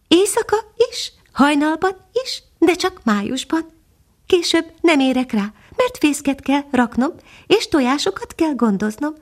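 a little hidden bird answering from the branches; tiny nightingale. Acted. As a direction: speak as a tiny, gentle songbird. Nightingale voiced in story.

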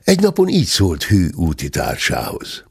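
ordinary delivery

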